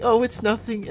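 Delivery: a hint of a girl saying "Oh, it's nothing"; mock woman brushing it off. Mocking how women dodge with "Oh, it's nothing". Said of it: "they're like".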